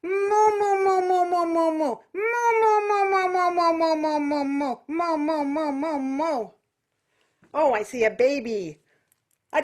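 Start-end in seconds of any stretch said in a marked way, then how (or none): 0.0-6.4 nasal moo. Shown as a demonstration. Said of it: "And how about moo".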